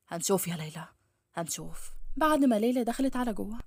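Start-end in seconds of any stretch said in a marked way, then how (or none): 0.1-1.9 low, restrained male threat; seething. A narrator's reading. Akram left outside the shut door, seething low. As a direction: sink low, simmering and trailing softer. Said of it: "كان بيغلي من الغيظ".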